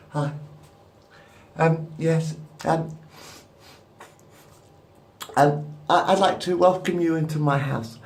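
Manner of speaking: high, halting pop-star impression. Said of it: "this is my impression of [name]".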